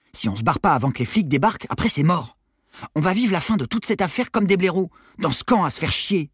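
high-pitched, urgent persuasion; impatient teenage Armand. Acted. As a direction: push a hesitant friend to run away. Armand urges Maddy to flee. (ado pressant qui s'emporte)

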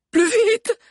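very high, loud order; urgent command. A narrator's reading. Sabi commanding the driver as pursuers near, urgent shout. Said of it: "commanda Sabi".

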